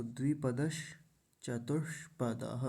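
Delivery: 'द्विपदश चतुष्पदः' with a pause between words; distinct sh sounds. Shown as a demonstration. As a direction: make both sh sounds clear in 'द्विपदश चतुष्पदः'. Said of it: "दोनों का उच्चारण एकदम पता चलना".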